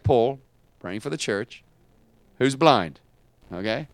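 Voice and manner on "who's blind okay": ordinary talk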